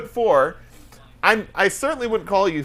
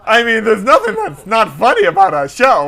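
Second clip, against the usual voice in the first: loud, hammed-up comic voice; put-on voice; 5 dB louder than usual. Co-host joking about the show, put-on voice. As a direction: keep up a loud silly voice. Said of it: "still doing this voice".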